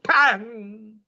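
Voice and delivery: mock twang of a drawn bowstring, high-pitched. Arjuna's bow Gandiva sounding through the jungle, a high mock twang. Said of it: "pulled the string and made the sound".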